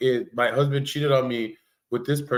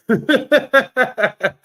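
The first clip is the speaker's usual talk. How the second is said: high, loud disbelieving burst of laughter; incredulous laugh; 10 semitones higher, 7 dB louder than usual. Real, not acted. Reacting to the chat's gossip with a high disbelieving laugh. Stunned by the Kimmy news.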